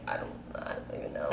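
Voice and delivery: whispered, fast, baffled. Retold or imitated. Reacting to the boyfriend walking away, whispered. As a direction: breathe it out, lost for words. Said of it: "I'm like".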